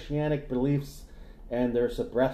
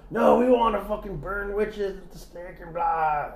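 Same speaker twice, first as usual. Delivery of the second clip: raised, fierce viking voice; mock viking metalhead; 7 semitones higher than usual. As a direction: growl it like a heathen warrior.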